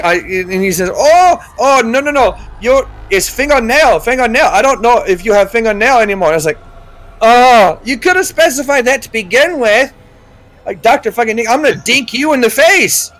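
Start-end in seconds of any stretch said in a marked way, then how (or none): 0.9-6.3 high-pitched imitation of a doctor's thick accent; mock Middle Eastern doctor accent, pitched up; thick foreign accent. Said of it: "he's talking in this accent that I can barely understand". 7.2-9.9 high, exasperated retort; mock indignation, pitched up; acted annoyance. 10.8-13.1 higher-pitched comic outrage; exasperated play threat; mock threat.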